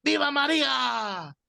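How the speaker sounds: high, hammed-up old man shouting; shrill-pitched mock cry of devotion; retold old man's yell. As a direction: mimic a fanatic old man yelling in his face. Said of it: "me grita a la cara".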